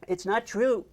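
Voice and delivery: high-voiced acted protest; insistent denial. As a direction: insist on it, exasperated. Acted exasperated reassurance, first take.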